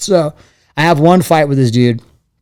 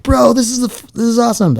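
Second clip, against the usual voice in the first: excited reaction; 9 semitones higher than usual. Retold or imitated. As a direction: imitate an amazed bro reaction. Reacting to old sparring footage.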